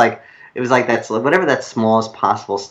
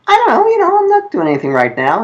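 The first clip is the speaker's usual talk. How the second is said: very high, playfully coy New York hemming; mock coy reluctance; 4 dB louder, faster than usual. Writer to producers, feigning reluctance with mock coy pitch. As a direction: pretend to be hard to get while clearly keen.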